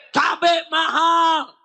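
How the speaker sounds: high, drawn-out mock protest yell; imitated crowd complaint, stretched long; protesting mothers' cry. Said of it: "Ibu-ibu teriak".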